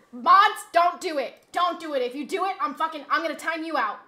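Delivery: normal talking voice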